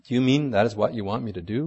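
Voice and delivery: normal talking voice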